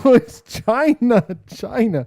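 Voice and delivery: high, slow, drawn-out gasp of discovery; mock realization. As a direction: ham up the shocking reveal.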